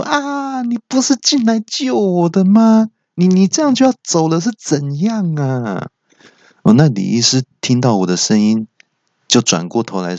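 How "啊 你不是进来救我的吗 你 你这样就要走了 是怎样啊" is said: high-pitched outcry of dismay; shouting in disbelief. Acted. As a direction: cry out much higher, protesting at being left behind. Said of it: "我就大喊说".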